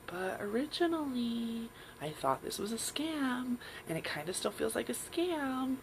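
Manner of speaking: a timid voice barely holding on; small doubtful voice. Retold or imitated. Her intuition pushing back, small and quiet. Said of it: "being like".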